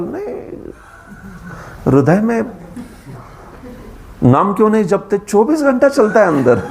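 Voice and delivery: rapid, high mock excuse of a lazy devotee. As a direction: rattle off the excuse like a smug layman. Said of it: "they talk like this".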